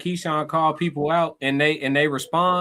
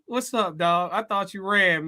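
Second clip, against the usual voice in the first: ordinary delivery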